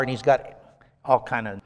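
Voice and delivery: ordinary talk, nothing marked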